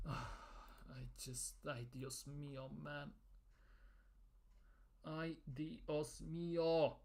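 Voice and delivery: very soft, low, sighed-out exasperation; weary despair. Real, not acted. Fan lamenting the captain's injury, wearily resigned. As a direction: let it out under your breath, defeated.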